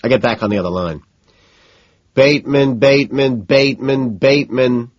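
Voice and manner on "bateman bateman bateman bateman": high repetitive drone; insistent singsong drone, pitched up; droning. A narrator's reading. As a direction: chant the name over and over identically. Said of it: "Hamlin is droning".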